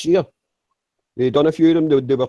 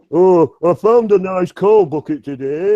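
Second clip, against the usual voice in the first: slow, higher, louder dull enthusiasm for a coal bucket; boring man voice; 4 semitones higher, 5 dB louder, slower than usual. Retold or imitated. The most boring man telling his family about his day, slow dull delight. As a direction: play the most boring man in the world, proud of nothing. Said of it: "Michael Palin plays the most boring guy".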